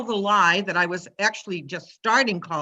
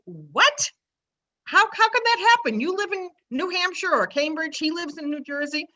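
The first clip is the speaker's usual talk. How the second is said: imitating people who can't believe it, pitched much higher and faster; mock astonishment; 6 semitones higher than usual. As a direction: play the stunned, incredulous friend. People react in disbelief.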